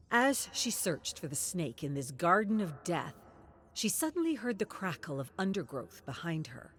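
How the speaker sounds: usual speaking voice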